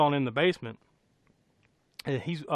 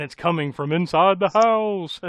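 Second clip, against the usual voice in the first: loud, raised dramatic announcement; hammed-up thriller line; mock-dramatic reveal; 4 semitones higher, 7 dB louder than usual. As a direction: deliver the horror twist with relish. Dramatic delivery of famous horror line.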